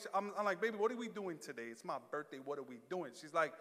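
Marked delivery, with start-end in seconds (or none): 0.6-3.1 very soft, lower-pitched questioning; meek asking. Retold or imitated. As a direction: ask your wife timidly, almost under your breath. Retells asking wife about birthday plans.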